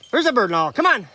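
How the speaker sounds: high, loud urging call; calling the dog. Real, not acted. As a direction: urge the dog on from afar.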